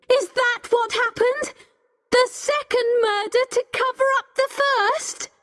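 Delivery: high, heated accusing questions; shouting accusation. A narrator's reading. Becky turning on Richard, shouting high questions. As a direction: demand an answer in a high, outraged voice. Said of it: "started shouting".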